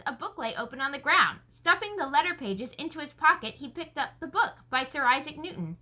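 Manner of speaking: ordinary delivery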